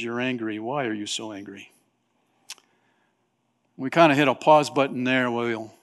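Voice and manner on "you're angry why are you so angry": gentle question. Acted. God voiced gently.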